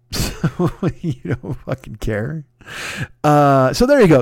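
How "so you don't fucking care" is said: slow words broken up by real laughter; cracking up while talking; laughing. Real, not acted. Cracks up at own tangent.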